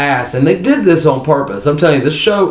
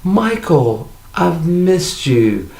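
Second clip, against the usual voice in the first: higher, slow, tender reunion line; imitating Kathy Bates greeting Michael, slow and higher; 4 semitones higher, much slower than usual. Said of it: "she goes".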